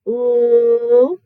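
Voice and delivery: "Ooh" as a loud, sustained l sound; loud isolated phonics sound. Shown as a demonstration. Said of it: "Let's see".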